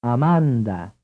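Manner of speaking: Amanda stressed on the second "ah"; ah-MAHN-dah; Italian stress. Shown as a demonstration. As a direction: lean on the middle syllable of Italian Amanda. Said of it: "Which vowel is stressed in the following?".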